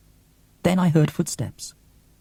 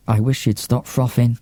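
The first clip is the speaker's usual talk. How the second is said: ordinary delivery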